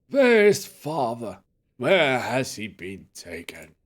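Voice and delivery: very slow, drawn-out dazed voice; confused old professor. Acted. Professor asking after his father, slow and dazed. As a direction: wail slowly like someone lost in the past.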